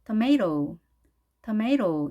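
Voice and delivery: tomato as tuh-MAY-roh, T softened to a flap; flapped T. Shown as a demonstration. Teacher demonstrating the R-like T in tomato, tuh-MAY-roh. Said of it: "R 발음으로 하죠".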